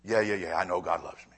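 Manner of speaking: low, dismissive yeah yeah; bored listener. Retold or imitated. Pastor voicing a jaded congregant, bored and dismissive. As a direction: brush it off, half listening. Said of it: "as you are listening to me".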